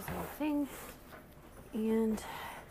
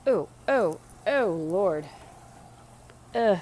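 loud disgust; 12 dB louder, slower than usual. Real, not acted. Smelly laundry, then men stink.

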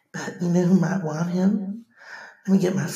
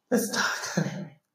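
unvoiced breathy whisper; 3 semitones lower, 6 dB quieter than usual. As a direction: lean in and whisper. Medium whispers to the guest.

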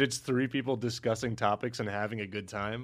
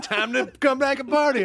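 fake party cheer; 11 semitones higher, 6 dB louder than usual. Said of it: "they're like".

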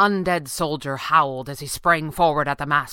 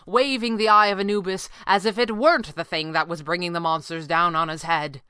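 plain reading voice

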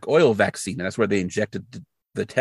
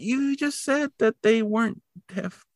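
pitched-up, exasperated objection; incredulous rant; 6 semitones higher than usual. Real, not acted. Viewer arguing with the mother on screen, pitched-up disbelief. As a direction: jab at the plot's logic. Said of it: "And I'm like".

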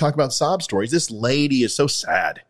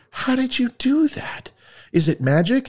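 amazed woman; 12 semitones higher than usual. Acted.

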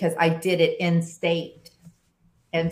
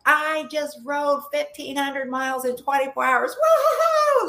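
much higher, hammed-up victory whoop; mock crazy celebration; 10 semitones higher than usual. Pretend cheer to a stranger at the gas station, hammed up. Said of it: "Like, be all crazy".

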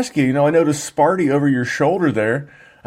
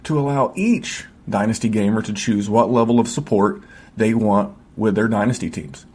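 upbeat promo voice-over read; 4 semitones lower than usual. Acted. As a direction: sell the Patreon like a radio spot. Podcast ad read over music.

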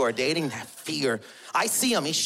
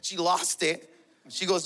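ordinary talk, nothing marked

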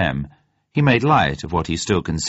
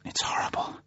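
whispered, tearful horror; hushed distress near tears; 12 dB quieter than usual. A narrator's reading. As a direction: barely breathe it out. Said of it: "Her eyes filled with tears".

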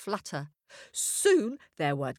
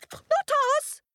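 much higher tiny-creature voice; squeaky innocent; 3 dB louder than usual. A narrator's reading. Hares deny.

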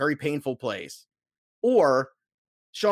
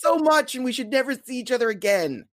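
put-on girl letting you down; 10 semitones higher than usual. Mimicking girl's breakup line.

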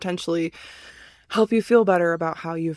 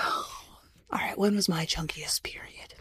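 whispering; 5 semitones lower, 10 dB quieter, slower than usual. Retold or imitated. Whispering her own thoughts.

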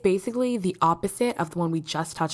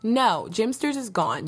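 usual speaking voice

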